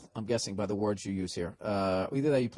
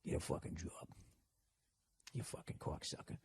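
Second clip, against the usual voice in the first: barely voiced mock mutter; mumbling; 7 semitones lower, 19 dB quieter than usual. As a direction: mumble under your breath to yourself. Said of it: "just mumbling".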